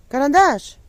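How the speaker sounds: high, loud call across the yard; calling the dog. Real, not acted. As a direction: call your dog from far away. (голосно кличе собаку)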